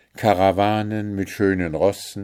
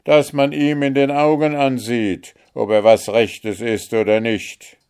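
loud, higher jeering shout; mocking shout; 4 semitones higher, 6 dB louder than usual. A narrator's reading. Guard gripping Märchen's chin, loud mocking shout. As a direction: yell it in her face. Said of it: "schrie er".

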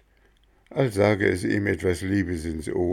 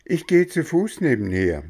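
higher, louder sweet coaxing; coaxing coachman; 9 semitones higher, 4 dB louder than usual. Acted. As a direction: cheer the sad boy up to lure him. Coachman answers Bengele.

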